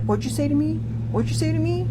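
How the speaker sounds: mock threat. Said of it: "But he's just like".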